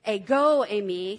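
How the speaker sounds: "Ego, Amy" said loud and emphatic, pushed hard; emphatic Greek. Shown as a demonstration. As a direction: declare "Ego, Amy" with force. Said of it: "it's very emphatic".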